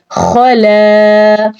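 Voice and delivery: slow, drawn-out tajweed chanting; Quranic recitation. Shown as a demonstration. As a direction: recite slowly, stretching each vowel. Recites the verse word. (धीमी तिलावत)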